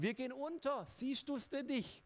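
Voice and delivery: high, panicked cry of drowning men; panicked disciples. Retold or imitated. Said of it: "die geschrien haben". (hohe, panische Stimme der Jünger in Seenot)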